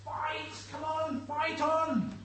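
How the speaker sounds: high-pitched urging cry; spectator's shout. Acted. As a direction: cheer on a struggling competitor. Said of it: "Or we might shout".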